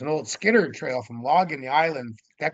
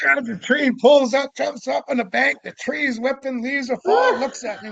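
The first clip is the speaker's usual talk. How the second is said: much higher, rapid-fire, louder storytelling; excited; 11 semitones higher, 6 dB louder than usual. Real, not acted. Storyteller reliving Sasquatch fleeing up the bank, excited. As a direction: tumble it out breathlessly.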